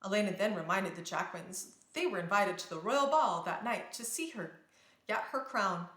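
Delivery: usual speaking voice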